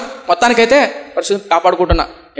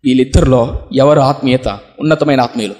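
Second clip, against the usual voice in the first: ordinary delivery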